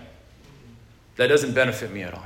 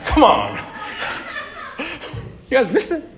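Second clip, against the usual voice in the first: much higher, slow, laughing question; laughing disbelief; 14 semitones higher, slower than usual. Real, not acted. Preacher laughing at the audience who missed the punchline. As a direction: tease the crowd for missing the joke. Joke fell flat with audience.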